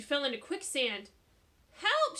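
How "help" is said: very high, loud cry for help. A narrator's reading. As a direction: scream as she sinks. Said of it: "she cried".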